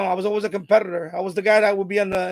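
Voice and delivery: normal talking voice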